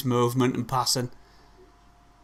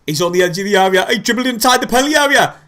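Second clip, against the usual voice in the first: excited build; 5 semitones higher, 5 dB louder, faster than usual. Real, not acted. Fan watching Ahmad dribble in.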